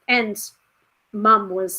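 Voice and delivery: normal talking voice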